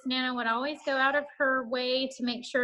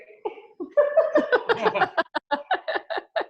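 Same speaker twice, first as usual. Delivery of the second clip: bright high laugh; 10 semitones higher, 4 dB louder than usual. Real, not acted. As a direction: burst out laughing. Laughs after teasing Eric.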